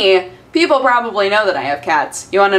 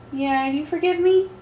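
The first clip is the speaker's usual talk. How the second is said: cooing; 7 semitones higher, 5 dB quieter than usual. Real, not acted. Cooing to the cat.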